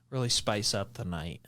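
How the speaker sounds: low, soft, teasing delivery; mock suggestive voice. Podcast host jokes about Valentine's date plans, mock suggestive. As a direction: drop it low and soft, half-joking. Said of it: "why I'm talking like that".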